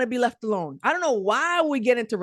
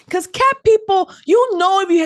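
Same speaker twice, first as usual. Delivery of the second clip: a punchy, raised stand-up point; emphatic; 8 semitones higher, 7 dB louder than usual. Real, not acted. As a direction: lean hard into cat people. Emphatic point about cat people.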